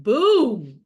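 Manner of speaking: punched loud boom. Retold or imitated. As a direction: shout it in capitals. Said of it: "all capitals".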